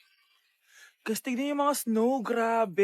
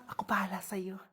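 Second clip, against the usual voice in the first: soft, conspiratorial whisper; secretive whisper; 4 semitones lower, 8 dB quieter than usual. Acted. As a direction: whisper it privately to a crush. Secretly assures crush. (pabulong na pangako)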